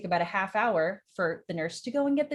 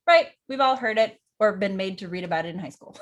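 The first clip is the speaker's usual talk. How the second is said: usual speaking voice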